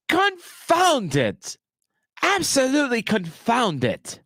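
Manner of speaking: high-pitched, drawn-out mock outrage; theatrical exasperation. Streamer cursing a missed chance with theatrical exasperation. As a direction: curse grandly, pitch high and falling.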